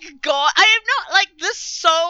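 loud protest. Real, not acted. Heated mock argument over egg pope.

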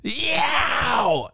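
high, piercing yowl; creature's scream. Retold or imitated. Creature in the headlights, high screaming yowl. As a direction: screech like the beast. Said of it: "and he's just like".

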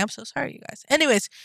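ordinary talk

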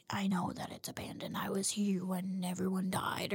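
hushed, eerie whisper. Retold or imitated. Jetpack guy telling the crew about the dead, in a whisper. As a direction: breathe it out like a haunted secret. Sad confession on abandoned ship.

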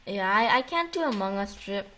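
ordinary talk

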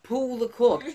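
high, loud yell; yelled command. Acted. Telling a joke, the Irishman yells to the monkey. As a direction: bark the order at the monkey. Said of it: "Irishman hears it and yells".

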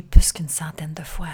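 sensual breathy whisper; breathy vintage purr. Pretend. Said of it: "parce que j'ai une voix sensuelle".

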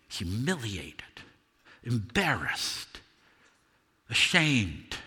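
slow, soft, broken words; crushed shame. Acted. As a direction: let each word sink in shame. Peter after weeping, full of shame.

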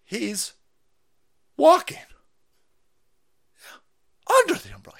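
high, loud, mock-ecstatic cry; hammed-up gushing. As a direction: shriek it like overjoyed fan. Mocking the clip's praise.

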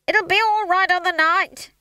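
high, loud frantic reassurance; screaming. A narrator's reading. Stage manager reassuring his cast, screamed. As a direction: shriek it over the panic. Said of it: "screamed Howell".

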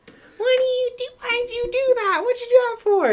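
put-on very high confused girl voice; mock bewildered stranger; imitating the girl. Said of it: "she's like".

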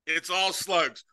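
ordinary talk, nothing marked

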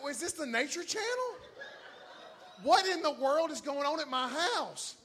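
high, loud incredulous outburst; exasperated disbelief. Retold or imitated. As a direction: play it baffled at the chaos. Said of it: "I'm like".

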